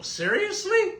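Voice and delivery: high squeaky "Seriously?"; a sudden high-pitched, incredulous "Seriously?"; mock disbelief. Real, not acted. Host reacting to being told too close.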